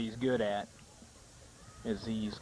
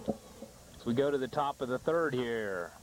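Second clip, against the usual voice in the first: normal talking voice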